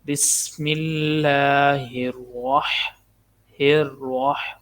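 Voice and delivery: الرح with a thick ر and breathy ح, slowly; heavy ra. Shown as a demonstration. Teacher showing the heavy ra, slow recitation. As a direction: make the ر of الرح full and heavy. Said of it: "را کے اوپر زبر ہے، اس کو موٹا پڑھنا ہے".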